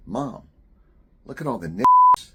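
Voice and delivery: put-on high, loud child's excited cry; child calling out. Young boy blurting to his mom at the parade, loud high call. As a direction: shout it like a kid pointing at the parade.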